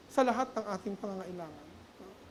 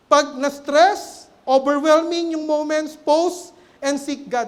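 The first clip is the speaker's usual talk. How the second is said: slow, louder, emphatic preaching; measured pulpit proclamation; 5 semitones higher, 10 dB louder, much slower than usual. Acted. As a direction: drive each phrase home to the congregation. Preaching at full force.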